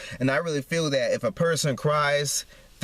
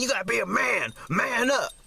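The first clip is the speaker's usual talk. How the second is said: mock taunt; 8 semitones higher than usual. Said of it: "they say".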